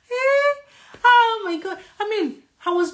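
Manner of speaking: very high, loud exclamation; high-pitched, loud "can you believe it"; amused disbelief. Real, not acted. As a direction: squeal it in disbelief at yourself. Exclaims over her pointless grudge.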